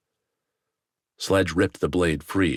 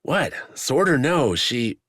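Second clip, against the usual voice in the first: startled shout; 9 semitones higher, 3 dB louder, slower than usual. A narrator's reading. Wax reacts startled, shouting.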